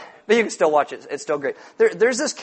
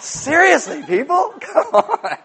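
high, laughing plea to the room; real laugh at his own flop; laughing disbelief; 11 semitones higher, 4 dB louder, slower than usual. Real, not acted. Pastor pleading with a silent crowd, laughing. Joke falls flat with congregation.

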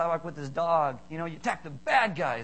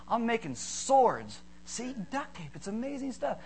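put-on awed small kid showing off his creation; little boy voice; 5 semitones higher than usual. Kid proudly shows his duct tape swords, little boy voice.

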